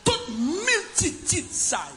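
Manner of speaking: high, slow held cry; drawn-out preaching cry. Acted. Preacher crying out to the congregation, high and slow. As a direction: stretch the words out high.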